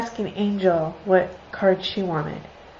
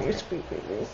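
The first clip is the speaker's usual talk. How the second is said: low, soft murmur to the dog; tender cooing; 6 semitones lower, 10 dB quieter than usual. Real, not acted. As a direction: melt over your sweet pet.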